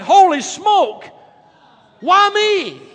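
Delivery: high, loud, drawn-out cry of despair; despairing shout. Retold or imitated. Stranded man crying out to God, shouting. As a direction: wail it up at the sky. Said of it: "now he's like".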